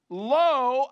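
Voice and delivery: "Lo" high, loud and stretched out; extreme emphasis. Acted. Preacher quoting the New King James, emphatic "Lo". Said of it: "a word of emphasis".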